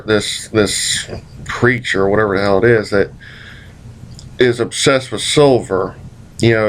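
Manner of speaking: normal talking voice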